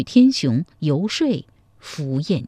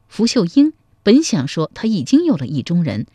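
plain reading voice

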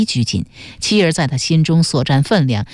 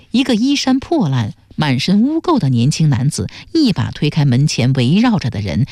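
ordinary delivery, nothing marked

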